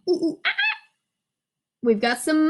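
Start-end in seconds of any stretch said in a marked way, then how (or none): 0.1-0.8 high playful "ooh ooh ah ah" monkey calls; monkey hoots. Pretend. Teacher turning to the monkey page for the class, playful monkey hoots. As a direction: hoot like a little monkey swinging in.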